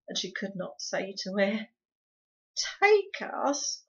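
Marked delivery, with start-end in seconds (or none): 2.8-3.8 strange, hollow and higher; stunned hollow echo. Acted. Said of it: "in a strange, hollow voice".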